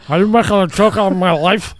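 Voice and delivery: loud, indignant put-on big-man voice; big man Mike. Offended Mike confronts reporter over his life's work, hammed-up indignation. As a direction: play the offended business owner, loud and strained. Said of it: "he was a big man".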